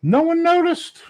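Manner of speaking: high incredulous echo; amazed squeak. Real, not acted. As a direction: stretch it out in astonishment. Amazed repeat of punchline.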